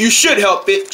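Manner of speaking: ordinary delivery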